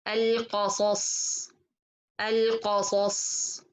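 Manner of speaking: "القصص" with ق and both ص heavy; heavy letters. Shown as a demonstration. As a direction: recite "القصص" carefully, tongue back on all three letters. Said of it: "put your tongue back in these three letters".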